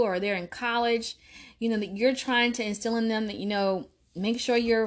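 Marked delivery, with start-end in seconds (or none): none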